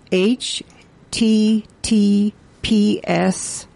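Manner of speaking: slow, spaced-out letters; very slow spelling. Real, not acted. Radio host reading out a web address for listeners, very slowly. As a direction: spell it out one letter at a time. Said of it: "gonna say this very slowly".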